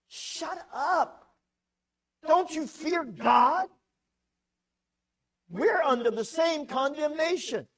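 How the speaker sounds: high, loud stern rebuke. Acted. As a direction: scold him and remind him of God. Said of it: "rebukes the first guy".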